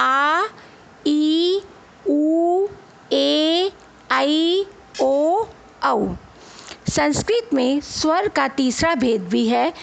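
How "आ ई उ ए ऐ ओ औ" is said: आ, ई, उ, ए each held long and rising; long vowels drawn out. Shown as a demonstration. Teacher showing children long vowels, each drawn out. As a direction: stretch every long vowel. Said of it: "हमने कैसे बोला?".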